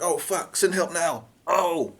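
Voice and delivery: high, drawn-out panic; terrified. Retold or imitated. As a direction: plead in fear. Terrified after shots.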